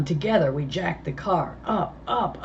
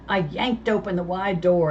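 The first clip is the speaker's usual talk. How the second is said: ordinary delivery, nothing marked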